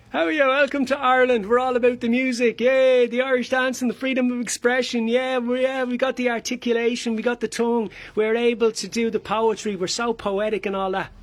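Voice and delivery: lively, singsong Irish patter; effusive Irish welcomer. Acted. Welcoming visitors to Ireland, effusive singsong Irish voice. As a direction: gush proudly about Ireland.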